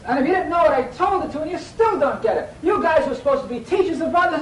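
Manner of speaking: high, loud, exasperated scolding; worked-up teacher at wits' end; emotional exasperation. Acted. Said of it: "it's so emotional".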